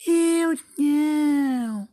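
very high, very slow squeaky refusal; a little rat stretching out its no; tiny rat voice. Acted. The rat refuses to help the hen, in a high drawn-out squeak.

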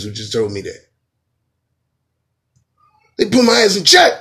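high, loud hammed-up scolding; mock yell. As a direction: chew him out loudly. Acted girlfriend scolding boyfriend.

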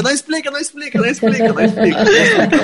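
high laughing protest rising; laughing plea. Real, not acted.

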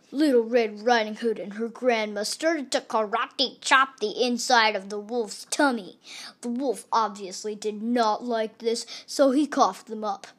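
ordinary delivery

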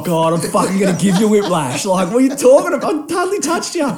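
loud, high retold rant; exasperated outburst. Driver disputing a whiplash claim, retold angry disbelief. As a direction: climb into incredulous protest. Acted quarrel with other driver.

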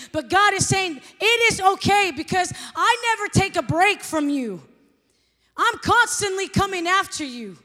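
loud, high, fervent sermon delivery; preaching at full force. Acted. Pastor preaching to her congregation, loud and fervent. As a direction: preach it out to the whole room.

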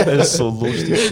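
slow words through laughter; laughing. Real, not acted. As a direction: say it while cracking up. Cracking up at the story.

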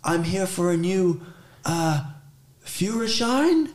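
high-pitched, hesitant customer at a counter; clueless English-speaking customer. Acted. English speaker asking an official for a licence, high and hesitant. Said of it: "speak English to them. Just be like".

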